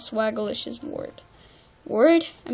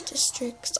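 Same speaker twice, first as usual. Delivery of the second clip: whisper; 12 dB quieter than usual. Real, not acted. Whispered word while picking server.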